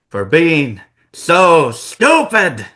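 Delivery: slow, loud mocking jab; villain reveals betrayal, slow and loud; heel sneer. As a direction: drag out the insult with contempt. Sami's heel turn reveal.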